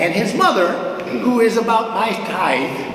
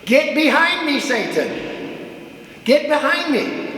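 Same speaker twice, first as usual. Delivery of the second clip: Jesus rebuking; 6 semitones higher than usual. Retold or imitated. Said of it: "What did he say to Peter".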